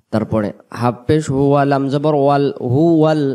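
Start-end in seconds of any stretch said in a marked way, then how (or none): none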